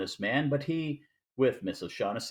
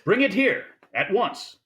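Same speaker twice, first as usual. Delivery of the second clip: eager command; 7 semitones higher, 5 dB louder than usual. Acted. Gutman eager for the Falcon.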